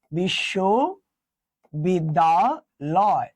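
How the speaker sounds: very slow, stretched বিশ্ববিদ্যালয় with gaps inside; slow teacher's word demo; drawn-out sounding out. Shown as a demonstration. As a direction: stretch each part of the word out slowly.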